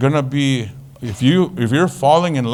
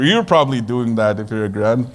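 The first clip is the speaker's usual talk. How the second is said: ordinary delivery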